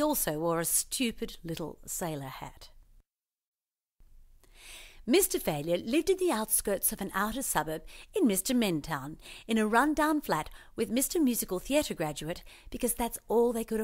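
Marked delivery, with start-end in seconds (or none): none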